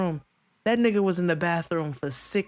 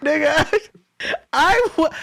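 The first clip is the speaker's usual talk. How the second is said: laugh-crying; 7 semitones higher, 3 dB louder than usual. Real, not acted.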